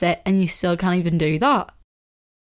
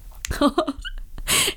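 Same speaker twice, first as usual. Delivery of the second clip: high burst of laughter; 4 dB quieter than usual. Real, not acted.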